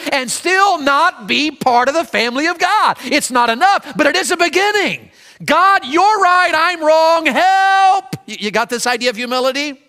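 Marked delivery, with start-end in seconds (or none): 5.4-8.2 higher, louder, slowly stretched cry to God; desperate plea. Acted. Preacher voices a desperate sinner crying to God, slow and loud. Short prayer example.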